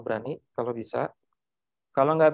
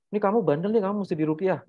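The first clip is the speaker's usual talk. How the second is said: lightly acted parent telling off a child, higher; mock scolding; 5 semitones higher, 3 dB louder, faster than usual. Parent tells a naughty child to get ruqyah, mildly scolding. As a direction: accuse in a raised, pretend-parent voice.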